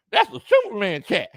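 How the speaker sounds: talking around a whistle in mouth. Shown as a demonstration. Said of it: "I gotta do it with a whistle in my mouth".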